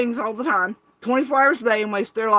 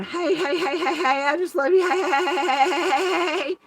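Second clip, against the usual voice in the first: high, bubbly repeated heys; mock gushing greeter; 11 semitones higher, 4 dB louder than usual. Mocking a creator greeting chat, high and gushing. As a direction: gush like an overeager streamer. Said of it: "the first thirty minutes going".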